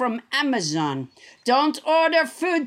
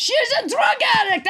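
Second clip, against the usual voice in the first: higher, faster indignant snap; snapping retort; 8 semitones higher, faster than usual. Acted. French Trump cutting off the BuzzFeed reporter, indignant snap. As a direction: cut her off, indignant.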